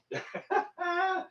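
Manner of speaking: high, soft giggle. Real, not acted. Laughs at own moon joke.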